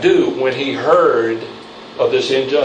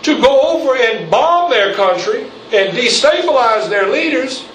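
rising, higher, building preacher's cry; preaching at full force; 8 semitones higher than usual. Real, not acted. Preacher to his congregation, condemning the bombing, building full-force preaching. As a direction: pound it home to the pews, climbing as you go.

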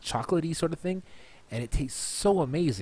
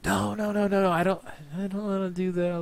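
higher mock protesting brother; brother refusing; 6 semitones higher than usual. Imitating brother Johnny turning down the idea, higher protesting voice. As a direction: play the brother flatly refusing. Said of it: "But Johnny's like".

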